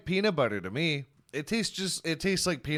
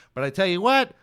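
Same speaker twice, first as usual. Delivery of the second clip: higher and louder, selling hard; hyped sales pitch; 5 semitones higher, 6 dB louder than usual. Acted. Host hypes ticket buyers with silly rewards, loud and giddy. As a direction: pump up the offer like a carnival barker.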